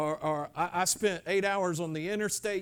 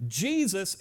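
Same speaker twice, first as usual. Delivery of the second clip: ordinary talk, nothing marked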